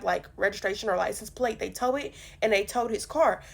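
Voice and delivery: usual speaking voice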